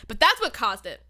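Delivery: ordinary delivery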